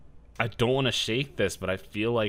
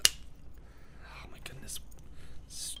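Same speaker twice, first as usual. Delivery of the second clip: whispering; 16 dB quieter, much slower than usual. Real, not acted. Struggling with bottle.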